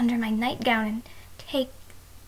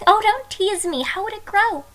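a high giggle, then a playful higher protest; giggling protest; 6 semitones higher, 4 dB louder than usual. Acted. Bride to husband who teases her: giggling protest. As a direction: laugh and push back playfully.

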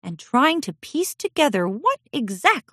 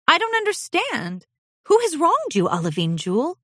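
much higher, concerned princess voice; puzzled princess; 10 semitones higher than usual. Acted. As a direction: ask gently but confused.

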